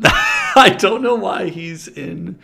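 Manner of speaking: high, loud, drawn out through laughter; laughing. Real, not acted. Host baffled by the [name] picture, loud laughing voice. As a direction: laugh helplessly while saying it.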